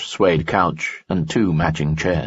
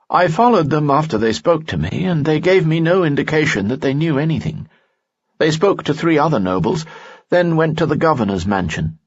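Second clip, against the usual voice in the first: higher, courtly nobleman voice; a charming noble, pitched up; Lanson's voice; 10 semitones higher than usual. Acted. Lanson speaks in story.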